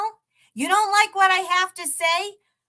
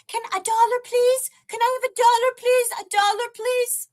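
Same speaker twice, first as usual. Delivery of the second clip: mock beggar; 6 semitones higher than usual. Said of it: "I'm out there with a basket, busking".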